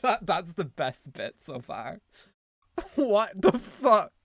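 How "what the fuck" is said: loud, slow, higher disbelief; shocked. Real, not acted. Streamer reacts to her own clip, stunned and loud.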